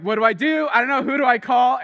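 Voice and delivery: high, loud panicked questions; imitating himself on the verge of a heart attack; retold panic. Youth worker alone at the group home, loud panicky cries. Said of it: "And I'm like".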